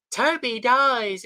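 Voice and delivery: high, drawn-out mocking call; spoiler-shouting moviegoer. Imitating moviegoer spoiling Endgame, high mocking singsong. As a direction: stretch it out in a high put-on voice. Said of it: "that was coming out and said".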